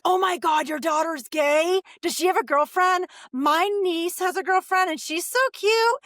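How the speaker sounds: high, loud delighted gushing; excited gushing. Retold or imitated. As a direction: gush like a thrilled church-lady friend.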